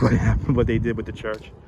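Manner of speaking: ordinary talk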